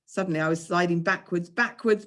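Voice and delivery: ordinary delivery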